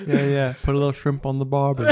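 loud, quick mock-Australian accent. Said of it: "that's supposed to be Australian".

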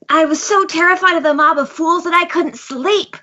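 hammed-up aggrieved villain; villain monologue. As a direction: makeshift villain recounting a wrong. Continues the villain's acted monologue.

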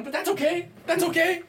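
sudden high, squeaky-pitched voice; mock reassurance. Host excusing a co-host's noisy phone, in mock-high reassurance. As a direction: jump way up high and pipe it out.

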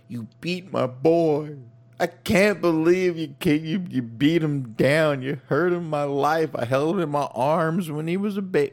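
high, loud, drawn-out wailing dad; slow, overwrought plea at full voice; mock tearful father promo. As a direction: ham up an anguished father's wrestling promo. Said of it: "cut a promo like this saying how".

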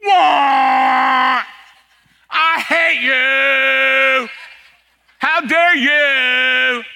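loud, drawn-out angry yell at another driver; mock road-rage yell. Pastor mocking a driver yelling behind a car, hammed-up shout. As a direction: ham up a furious honking driver.

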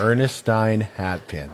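slow and careful, each syllable clear. Shown as a demonstration. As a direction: spell out Ernestine Hatpin slowly. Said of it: "You could slow that".

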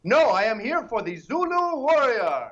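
loud, high heroic proclamation; mock warrior declaration. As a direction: announce yourself like a hammed-up warrior. Acting out a warrior's grand declaration.

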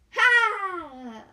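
a single forced loud "ha"; fake laugh at a joke. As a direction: bark out a hammy laugh at your own joke. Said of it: "you have to make the sound effect".

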